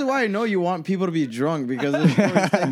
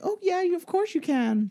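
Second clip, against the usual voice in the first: high, eager fake-friendly reply; high mock waiter voice; 3 dB quieter than usual. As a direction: play the chirpy waiter.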